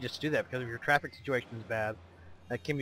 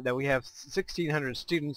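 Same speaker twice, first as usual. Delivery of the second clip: ordinary talk, nothing marked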